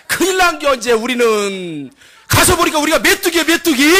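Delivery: high Chungcheong panic; hammed-up alarm in Chungcheong speech; Chungcheong dialect. Spy laments being grasshoppers before giants, Chungcheong accent. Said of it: "충청도 버전으로".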